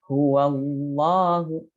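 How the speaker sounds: slow huwallahu with a full, dark lam; thick lam. Shown as a demonstration. Teacher repeats the example slowly. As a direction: drag huwallahu out with a heavy lam. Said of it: "wa-nya berharokat fathah".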